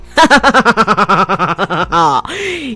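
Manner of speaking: long, descending rattling laugh; teasing cackle. Real, not acted. Host teasing callers about their secret task, cackling. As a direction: draw out a cackle that trails down.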